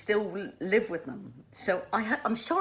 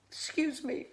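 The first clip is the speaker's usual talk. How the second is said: tearful apology; 8 semitones higher, 4 dB quieter than usual. Real, not acted. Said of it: "I'm sorry I got emotional".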